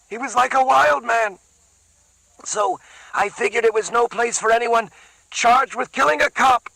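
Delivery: Whitey talking fast to save his skin; Whitey's voice. A narrator's reading. Whitey tells long escape story.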